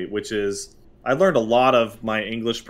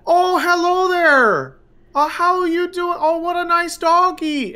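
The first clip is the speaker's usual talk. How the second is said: much higher, slow, sing-song lady voice; sweet lady; 4 dB louder than usual. Retold or imitated. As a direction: coo at the dog like a sweet older lady. Lady walking her dog.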